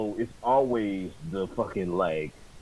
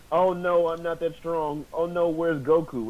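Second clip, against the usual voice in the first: mock helplessness; 7 semitones higher than usual. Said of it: "He's always like".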